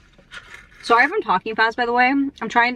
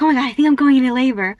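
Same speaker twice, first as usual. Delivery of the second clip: retold alarm; 3 semitones higher, 5 dB louder, faster than usual. Retelling her alarm at labor.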